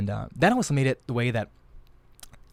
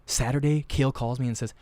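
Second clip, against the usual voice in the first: ordinary delivery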